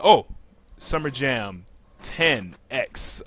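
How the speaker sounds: ordinary talk, nothing marked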